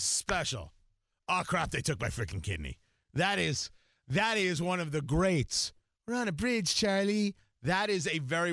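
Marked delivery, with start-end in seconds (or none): 1.3-2.8 lower, lightly voiced cartoon unicorn; a hint of a dopey cartoon voice; cartoon character quote. Quoting Charlie the Unicorn cartoon. 6.1-7.4 higher, lightly voiced cartoon unicorn; a hint of a chirpy cartoon voice; cartoon character quote. Quoting Charlie the Unicorn cartoon.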